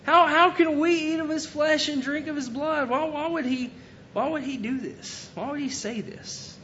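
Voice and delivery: high, baffled voice of the doubting crowd. Retold or imitated. Doubting listeners ask how he could say this, high and baffled. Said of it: "again, they said".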